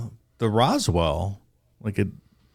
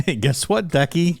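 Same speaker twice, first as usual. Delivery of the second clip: teasing sing-song; 9 semitones higher than usual. Acted. Taunting the dog.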